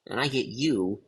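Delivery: usual speaking voice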